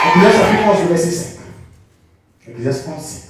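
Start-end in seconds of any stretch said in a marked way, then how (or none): 0.1-1.4 loud verse announcement. Acted. Preacher announcing verse loudly.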